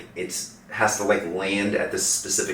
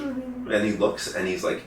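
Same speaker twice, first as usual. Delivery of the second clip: ordinary talk, nothing marked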